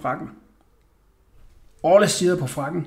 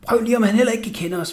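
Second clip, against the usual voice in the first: ordinary delivery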